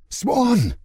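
sharp high shout of the name; loud call. Acted. Mother shaking her daughter awake, shouting her name. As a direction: yell her awake, urgent.

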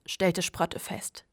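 plain reading voice

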